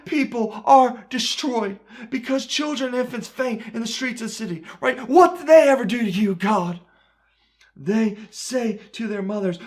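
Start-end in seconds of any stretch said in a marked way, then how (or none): 5.1-6.8 loud, fast cry flung at God in grief; anguished shout. Acted. Jeremiah crying out to God over dying children, anguished shout. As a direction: shout it up at heaven, pleading and hurt.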